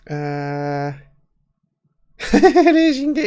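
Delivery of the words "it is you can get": loud, high laughing words; high, excited laugh through the words; delighted laugh. Real, not acted. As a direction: burst out laughing as you speak. Delighted at discovering European qualification.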